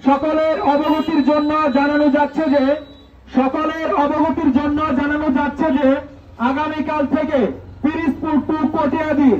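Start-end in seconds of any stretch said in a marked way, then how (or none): none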